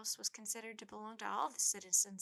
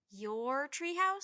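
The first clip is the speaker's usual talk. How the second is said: incredulous; 5 semitones higher, 8 dB louder, much slower than usual. A narrator's reading. Jack incredulous at claim.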